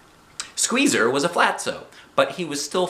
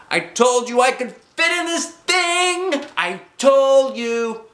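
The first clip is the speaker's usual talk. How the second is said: very high, drawn-out crowing; triumphant calling; 5 dB louder, slower than usual. A narrator's reading. Girl proves her parents wrong, calling out triumphantly. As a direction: crow it slowly across the pool. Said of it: "I called".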